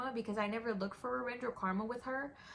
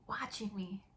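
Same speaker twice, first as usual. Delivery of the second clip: breathy whisper. Real, not acted. Repeating the words about her bully, whispered. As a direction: whisper it to herself.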